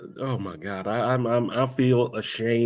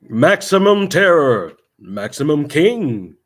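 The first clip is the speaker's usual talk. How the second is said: big, slow trailer-announcer read; slow booming trailer read; movie trailer voice; 3 semitones higher, 6 dB louder, slower than usual. Acted. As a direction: sell it like a cheesy trailer. Reading bad taglines aloud.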